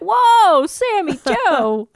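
loud, slow whoa; mock surprise. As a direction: act scandalised. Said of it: "you would have been like".

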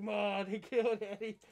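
lightly voiced Eddie Kingston, a hint of the wrestler; Eddie Kingston impression. Host recalls his friend's Eddie Kingston bit, lightly imitated. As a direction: toss off his line in a half-hearted imitation. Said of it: "You're doing your Eddie Kingston impression".

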